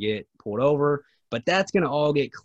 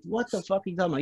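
retold indignant protest; 6 semitones higher, much faster than usual. Driver answering the cop's accusation of evading, retold indignant protest. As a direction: protest in mock outrage, pitched up without shouting.

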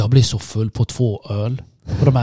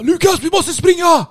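high-pitched, drawn-out yell; retold shout; slower than usual. Traveller yelling to his mate in the airport toilet, high urgent shout. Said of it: "Så jag skriker ju".

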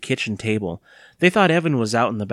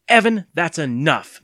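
loud, higher-pitched, drawn-out scolding; snapping; 6 semitones higher, 6 dB louder, slower than usual. A narrator's reading. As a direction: cut the child off firmly, louder. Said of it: "Missus Ross snapped".

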